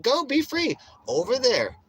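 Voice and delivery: high, loud acted send-off; high mock invitation. Man sending troublemakers out of his area, high mock send-off. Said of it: "I'm like".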